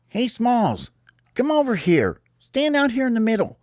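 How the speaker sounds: high-pitched summoning call; high, bossy little organizer; bird calling a teammate. Acted. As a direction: call over in a squeaky voice. Calls Smalls over.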